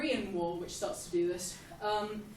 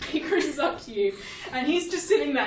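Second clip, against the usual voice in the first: ordinary delivery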